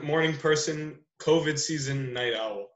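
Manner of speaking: usual speaking voice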